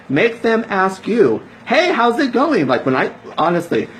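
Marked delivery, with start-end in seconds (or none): 1.6-2.7 bright friendly greeting. Retold or imitated.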